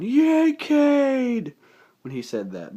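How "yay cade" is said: high, loud cheer. Retold or imitated. Cheering the hero in the theater, high and loud. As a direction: whoop for the hero. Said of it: "And I was going".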